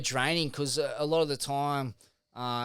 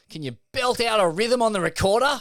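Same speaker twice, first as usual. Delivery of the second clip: mock cheeky request; 7 semitones higher, 7 dB louder than usual. Mock request to [name].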